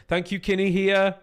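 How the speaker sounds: bright, loud shout-out to a donor; loud cheery thanks. Real, not acted. Streamer loudly thanking a donor in chat, excited. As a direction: call the thanks out high and loud.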